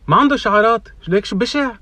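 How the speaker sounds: high, loud mimicry of a little boy; imitated boy. Seven-year-old boy remarking on a stranger in the elevator, imitated child voice. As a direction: pipe up like a cheeky kid, high and loud. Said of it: "the boy looks at me and he goes".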